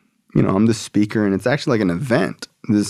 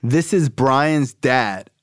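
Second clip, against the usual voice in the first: higher-pitched announcer call, drawn out; big announcement; 6 semitones higher, slower than usual. Retold or imitated. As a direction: announce the guest to the whole room. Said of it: "makes a big announcement".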